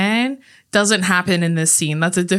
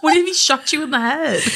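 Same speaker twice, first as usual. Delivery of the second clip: mock question; 7 semitones higher than usual. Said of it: "He's like".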